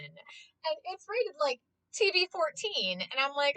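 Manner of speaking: ordinary talk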